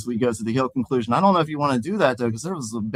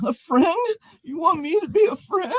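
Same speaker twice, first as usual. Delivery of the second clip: high pleading voice; pleading whine; 14 semitones higher than usual. Acted. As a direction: beg like a lonely crybaby. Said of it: "pleaded Crying Pete".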